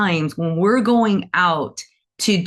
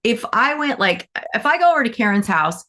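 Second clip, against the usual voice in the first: ordinary talk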